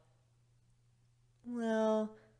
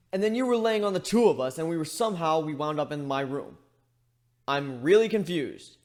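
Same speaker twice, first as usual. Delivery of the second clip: louder, higher frantic babble; panicked rambling; 4 semitones higher, 7 dB louder than usual. A narrator's reading. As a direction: spill it all out in a panic. Panicked rambling after waking.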